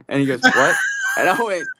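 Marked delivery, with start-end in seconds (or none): 0.4-0.8 sharp what. Retold or imitated. [name] shouting back at the starstruck stagehand, loud and startled. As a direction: snap out a startled question.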